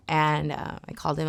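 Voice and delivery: normal talking voice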